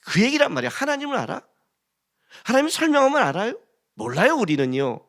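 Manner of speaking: usual speaking voice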